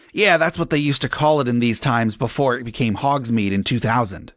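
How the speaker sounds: ordinary delivery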